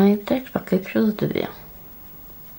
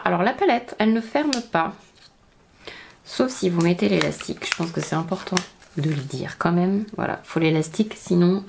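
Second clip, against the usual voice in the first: ordinary talk, nothing marked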